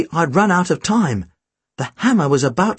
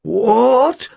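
high, dismayed groan; stretched groan of disbelief; 6 semitones higher than usual. A narrator's reading. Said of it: "the auctioneer groaned".

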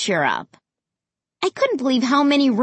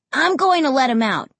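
firm, slightly higher announcement; declaring; 4 semitones higher than usual. A narrator's reading. Josh tells the family he'll let the dog out, declaring. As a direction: declare it with resolve. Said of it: "Josh declared".

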